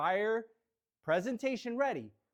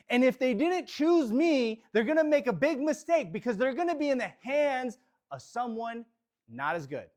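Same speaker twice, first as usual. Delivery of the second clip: larger voice; 2 semitones higher, 4 dB louder than usual. Acted. Agent overcoming self-doubt with affirmations, in a big confident voice. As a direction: say it out loud with conviction, building louder. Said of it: "You overcome that with a larger voice".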